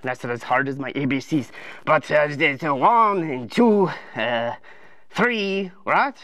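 low, halting grumble; hesitant, effortful counting. Acted. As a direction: count uncertainly in a cartoon king voice.

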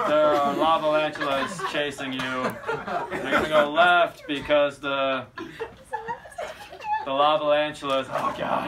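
low, halting, despairing monotone; contemptuous film director. Retold or imitated. Director loathing the creature film directs extras, low weary disdain. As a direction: force out each instruction, hating every word. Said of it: "he hates it so much".

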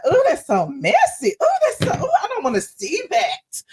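high, squealed ooh; mock-scandalized reaction; amused recoil. Retells her shocked, amused reaction.

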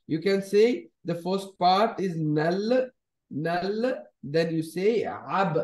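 slow split of نلعب into "nal" then "ab"; نلعب spelled out as nal … ab; syllable by syllable. Shown as a demonstration. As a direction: break نلعب apart slowly: nal, then ab. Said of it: "you can pronounce it one by one".

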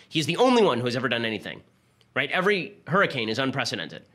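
usual speaking voice

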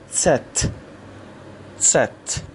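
ست opening on a ts blend; German Z as tset. Shown as a demonstration. Teacher drills the name of letter Z, ts blend. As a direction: say ست as "tset", each take set apart. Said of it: "ت و س رو به دنبال هم".

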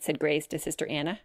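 ordinary delivery

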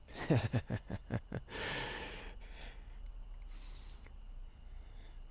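long, quiet, voiceless laugh; wheezy laugh. Acted. Boaster tickled by his own claim, wheezy laugh. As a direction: laugh softly, trailing away in breath.

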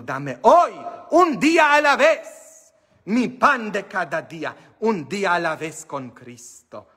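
high, loud preaching of the prayer; rising to a loud high call; proclaimed prayer. Acted. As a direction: cry the prayer out to the congregation.

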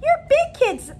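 high, loud cheerful exclaim; excited exclaim. Acted. Parents cheering their little ones on the first day, high and bright. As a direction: gush at the kids like a proud parent.

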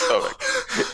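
very high, laugh-choked squeak; helpless laughter. Real, not acted. Friend overcome by the joke about pornos, helpless laughter. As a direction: squeeze it out through real laughter.

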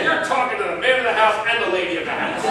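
father laughing into the phone at a caller; laughing reply. Retold or imitated. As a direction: crack up while answering the caller. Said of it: "I said".

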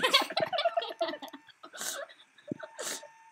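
squealing laugh, cracking high; helpless high-pitched laugh. Real, not acted. [name] botches trailer hookup.

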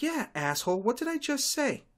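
sarcastic exasperated comeback; exasperated retort. Acted. Snapping at the repeating customer.

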